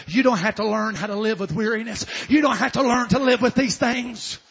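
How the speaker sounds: loud exhortation rising to a high shout; building preaching. Real, not acted. Preacher urging people not to accept weariness, building to shouting. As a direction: build to a full-force pulpit cry.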